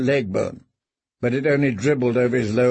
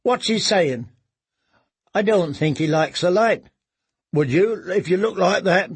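put-on boy voice; 5 semitones higher than usual. Boys talking.

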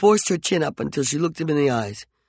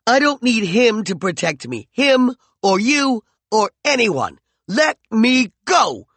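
higher, louder and slowing furious demand; angry demand; 9 semitones higher, 6 dB louder, slower than usual. Acted. Peregrine furiously demands McCoy release her, higher and louder. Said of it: "she demanded angrily".

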